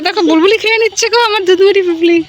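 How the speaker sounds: high, loud playful complaint; mock-distressed, high and loud; mock outrage. Real, not acted. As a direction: cry out that the goat is eating your food. Goat eats her milk.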